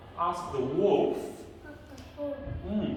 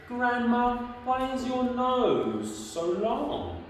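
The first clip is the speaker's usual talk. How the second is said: high, stretched wondering question; 6 semitones higher than usual. Acted. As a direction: keep it high and childlike.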